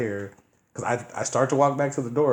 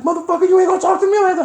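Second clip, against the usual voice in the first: high, loud, fast rage; furious yell; 12 dB louder, faster than usual. Retold or imitated. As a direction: scream it in fury. Voicing the egg thrower.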